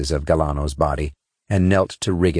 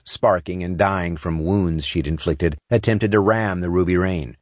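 ordinary delivery, nothing marked

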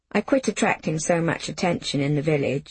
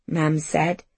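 usual speaking voice

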